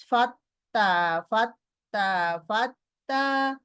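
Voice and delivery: ফাত্তা repeated slowly, long held double ত each time; doubled consonant drill. Shown as a demonstration. Teacher drilling students on a doubled letter, slowly. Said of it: "be very conscious".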